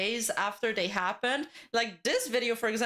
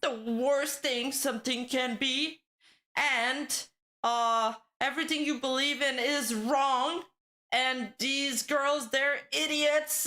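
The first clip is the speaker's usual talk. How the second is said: over-the-top drama YouTuber; 4 semitones higher than usual. Pretend. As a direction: ham up the outrage like a drama channel. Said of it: "Next time I'll be like".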